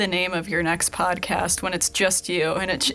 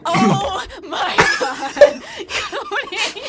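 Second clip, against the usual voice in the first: high, loud laughing cry of his name; laughing shout; 12 semitones higher, 6 dB louder, much slower than usual. Real, not acted. Co-host cracking up at Cody's joke, laughing shout. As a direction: burst out laughing at him.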